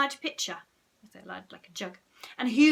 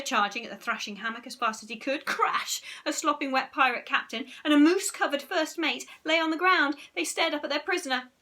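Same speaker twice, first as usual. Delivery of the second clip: plain reading voice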